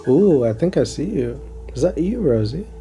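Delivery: ordinary talk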